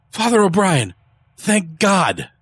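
high, loud cry of relief; relieved outburst. A narrator's reading. Early greeting the priest he recognizes, relieved high cry.

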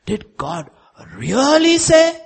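high, drawn-out insinuating question; devil's questioning. Retold or imitated. Devil questioning God's word, high and drawn out. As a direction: climb sharply into a stretched-out challenge. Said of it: "asked in the Bible is by the devil".

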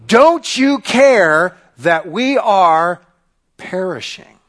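loud, slow, desperate plea from the sailors; panicked sailors. Acted. Sailors rousing Jonah in the storm, a loud desperate cry. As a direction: shake him awake with a loud cry.

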